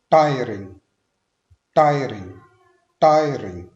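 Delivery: Tiring loud, weight on टाय; loud stress drill. Shown as a demonstration. Teacher drills tiring loudly for the class. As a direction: call out tiring, hitting टाय hard. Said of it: "stress हमने उसी तरह first syllable पे देना है".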